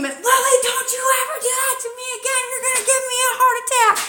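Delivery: high, fast scolding scream; screaming at daughter. Retold or imitated. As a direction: shriek at her, scared and furious, racing. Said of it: "And I'm screaming".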